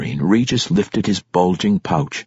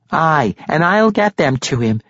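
insisting; 9 semitones higher than usual. Acted. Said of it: "Shasta Furfoot insisted".

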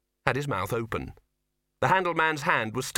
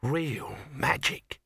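breathy awe; 3 semitones higher, much slower than usual. A narrator's reading. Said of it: "breathed Sol".

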